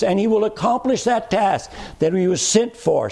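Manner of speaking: high, ringing preacher's climb, pressing every phrase; full-force preaching. Acted. Preacher proclaiming Christ's mission to the congregation, ringing and high. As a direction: drive it home from the pulpit, rising and insistent.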